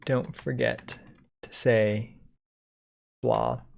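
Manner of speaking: normal talking voice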